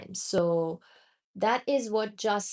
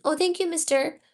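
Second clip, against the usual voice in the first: usual speaking voice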